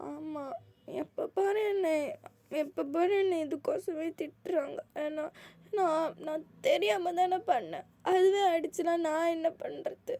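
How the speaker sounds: high tearful sobbing complaint; crying. Acted. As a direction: sob out the unfairness. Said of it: "அழுறியாடா".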